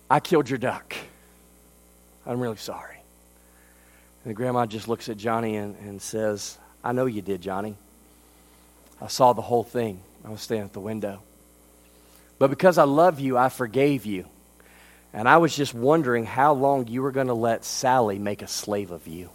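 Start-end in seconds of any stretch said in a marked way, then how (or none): 0.1-3.0 put-on softer, ashamed little-boy confession; quiet confession. 6.8-19.3 lower, much softer tender grandmother; gentle grandma. Retold or imitated. Grandma gently reveals she saw.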